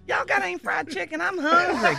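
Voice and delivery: high, loud mock whine for food; hammed-up hungry demand; John Boy. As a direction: holler for food, imitating a hungry buddy. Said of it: "Then says".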